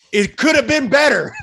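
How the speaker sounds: high, loud exasperated outburst; exasperated protest. Real, not acted. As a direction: protest it loudly, pitch way up. Exasperated argument about a film.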